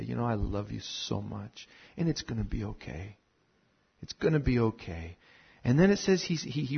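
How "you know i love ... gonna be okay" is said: much softer, lower, gentle comfort; God quieting his children through hard times; tender reassurance. Retold or imitated. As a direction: hush a hurting child with love.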